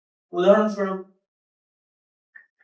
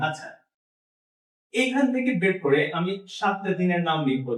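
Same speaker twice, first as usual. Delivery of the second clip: ordinary talk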